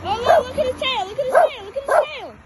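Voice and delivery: excited. Real, not acted. Excited at dog.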